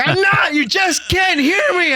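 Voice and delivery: very high, shouted denial; angry mom imitation. Mom denying she is angry, shouted imitation. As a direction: yell it insistently, stretching the words. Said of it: "And she's like".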